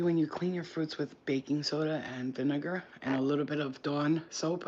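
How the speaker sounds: ordinary delivery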